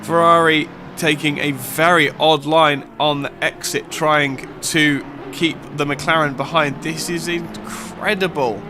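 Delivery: fast, lively play-by-play call; live race commentary. Acted. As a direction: call the final laps like live sport.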